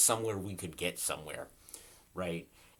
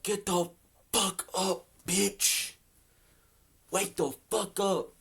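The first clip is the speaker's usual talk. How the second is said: high, loud, stretched-out yell; high hollered wake-up, ending slow; shouting at friend; 12 semitones higher, 4 dB louder, slower than usual. Retold or imitated. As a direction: scream up at his window to drag him out of bed. Yelling at friend to get up.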